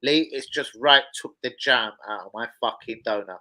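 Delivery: ordinary talk, nothing marked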